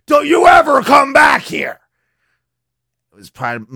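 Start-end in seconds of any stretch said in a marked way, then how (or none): 0.1-1.8 put-on high, loud yell at a kid; mock shout. Homeowner yelling at the scared kid, a loud shout. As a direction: bark it out to scare him off. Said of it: "I just said".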